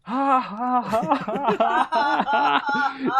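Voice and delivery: high, evenly spaced fake ha-has; forced laugh. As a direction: imitate a stilted, counted-out laugh. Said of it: "It's seven laughs".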